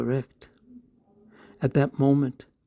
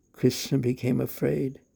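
ordinary delivery, nothing marked

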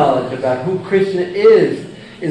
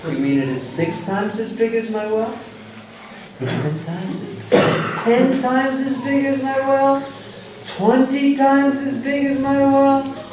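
escalating frog questions; 7 semitones higher, 5 dB quieter than usual. Acted. Frog in well guesses bigger.

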